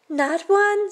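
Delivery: queen agreeing with the boasting king; queen's voice. Acted. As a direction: flatter the king eagerly.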